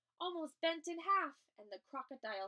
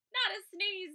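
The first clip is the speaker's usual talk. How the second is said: alarmed plea; 7 semitones higher, 7 dB louder than usual. Acted. Bees pleading.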